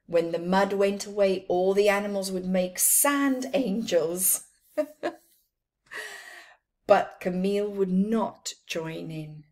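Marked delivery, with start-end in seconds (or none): none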